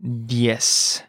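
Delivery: "Diez" drawn out slowly; slow model. Shown as a demonstration. Teacher modelling vocabulary for learners, slow "Diez". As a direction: stretch out each sound of "Diez".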